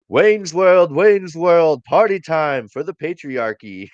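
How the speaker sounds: loud, high commentator call; imitated ringside shout, easing off at the end; Nigel. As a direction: belt it out like an excited wrestling commentator. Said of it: "Nigel said".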